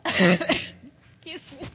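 choking cough on water; real coughing fit after swallowing wrong. Real, not acted. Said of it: "That water went down the wrong pipe".

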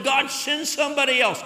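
high and loud, begging God to stop; desperate plea. Retold or imitated. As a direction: throw the plea up to heaven. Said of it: "I closed the door and said".

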